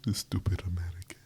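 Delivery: hushed insult under the breath; whispering. Acted. Muttered insult aside.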